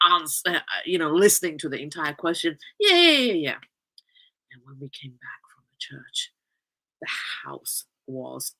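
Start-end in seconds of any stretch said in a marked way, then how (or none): 2.8-3.6 higher, louder, rapid-fire yeahs; brushing-off yeah yeah. Retold or imitated. Answering dad before he finishes, loud dismissive yeahs. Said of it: "I'm like, before he even". 4.5-8.5 lower, hushed storytelling whisper; suspenseful whisper. Real, not acted. Building suspense for listeners, soft whispered lead-in.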